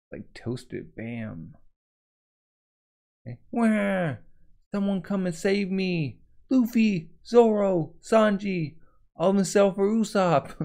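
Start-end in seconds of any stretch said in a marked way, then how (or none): 3.5-10.5 higher, louder panicked cries for help; panicked Nami. Acted. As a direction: shout for the crew in a panic. Voicing Nami panicking, calling crew.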